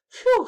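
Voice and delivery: very high, loud sigh of relief; relieved phew. Acted. Arthur relieved the line came out right, a loud phew. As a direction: blow out a big phew. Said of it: "said Arthur".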